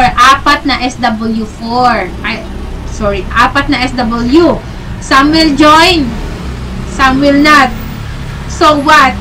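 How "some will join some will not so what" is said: teacher presenting the SW4 slogan word by word, slow and deliberate. Shown as a demonstration. Teacher unveils the rule to the sellers, slow and emphatic. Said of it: "Ano ba yung S W four?".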